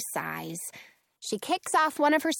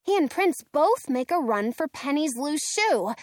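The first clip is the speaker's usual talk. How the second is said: usual speaking voice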